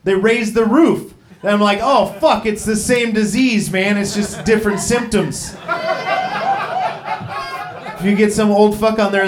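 normal talking voice

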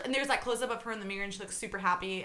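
normal talking voice

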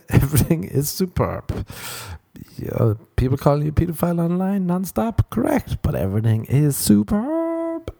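sarcastic high insistence all is fine; mock cheerful. Comic insisting online abuse is fine, high mock cheer.

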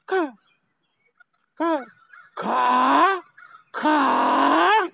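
spaced caws building to high, long cries; lookout crow's caw. Shown as a demonstration. Lookout bird sees a hawk, caws rising into alarm. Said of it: "the bird is keeping watch, go".

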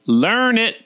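high-pitched, catty southern drawl on the title. Acted. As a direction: announce it with a syrupy southern lilt. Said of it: "softly in his cattiest southern drawl".

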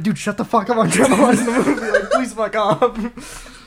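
loud, hammed-up moviegoer complaint; mock annoyance. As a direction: play fed up, laughter creeping in. Acts annoyed moviegoer shushing someone.

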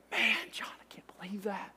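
hushed, voiceless disbelief; barely audible amazement; awed whisper. Retold or imitated. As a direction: whisper it, overcome by the news. Moved reaction to friend's news.

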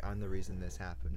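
low, hushed smug boast; smug toad voice. Pretend. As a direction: gloat softly like a proud toad. Trevor's smug poster caption.

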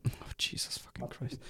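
whispered groan of exasperation; exasperated whisper. Real, not acted. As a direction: breathe it out in disbelief. Exasperated at long patch notes.

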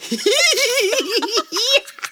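high-pitched helpless laugh. Real, not acted. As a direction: break into squealing laughter. Laughing at herself.

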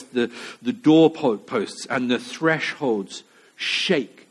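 normal talking voice

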